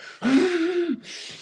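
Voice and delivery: a high, startled gasp of breath; sharp high gasp. Retold or imitated. Telling mates about the morning, a high startled gasp. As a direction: gasp like the ketamine just hit you. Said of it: "I'm here like".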